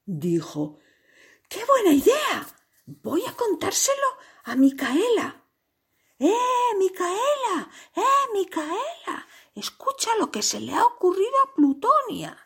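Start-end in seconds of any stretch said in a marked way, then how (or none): 1.5-12.4 very high, squeaky excited giraffe; thrilled character voice. A narrator's reading. Giraffe calling Micaela to share the plan, excited. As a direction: pipe up high and call eagerly to a friend.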